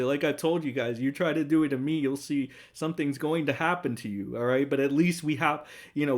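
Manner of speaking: ordinary talk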